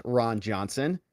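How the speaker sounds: usual speaking voice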